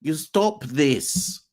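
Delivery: slow, drawn-out stop this; a weary final telling-off; slow scolding. Real, not acted. Scolding West Indies fans, slow and weary.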